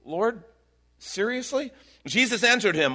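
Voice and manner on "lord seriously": high, disbelieving Lord, seriously; voicing a shocked Peter; incredulous. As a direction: pitch it up in disbelief. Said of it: "it's like this incredulous thing".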